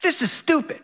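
hint of a sulky child's whine; whiny kid.

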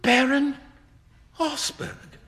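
high-pitched, halting disbelief; astonished cry. A narrator's reading. Young man learns the beggar's identity, aghast. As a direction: gasp out the name in two pieces. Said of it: "cried Hughie".

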